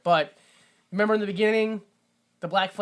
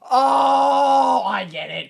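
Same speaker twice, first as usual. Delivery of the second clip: sudden realization; 6 semitones higher, 7 dB louder, much slower than usual. Retold or imitated. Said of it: "looks at milk and goes".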